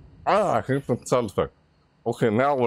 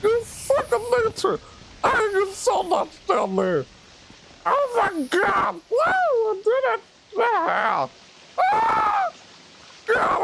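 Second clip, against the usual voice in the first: shrieking relief; slower than usual. Real, not acted.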